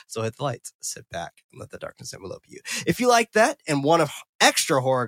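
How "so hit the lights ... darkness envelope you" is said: hushed spooky tagline. Pretend. Host invites listeners into the darkness, hushed and ominous. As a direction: drop low and soft, sinking away.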